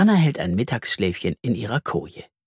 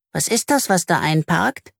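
little Anna asking; 4 semitones higher than usual. Acted. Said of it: "fragt die kleine Anna".

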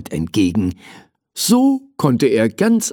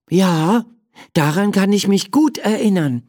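higher-pitched, keen reply; child character replying; 8 semitones higher than usual. Acted. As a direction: answer as the story's child, voice lifted. Story's child answers the teddy. (mit hoher Stimme erwidernd)

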